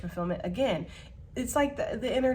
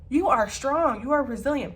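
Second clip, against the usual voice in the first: awed admiration; 4 semitones higher, 6 dB louder than usual. Acted. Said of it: "They would be just dumbfounded".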